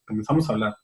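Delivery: pencil under tongue. Shown as a demonstration. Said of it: "debajo de la lengua".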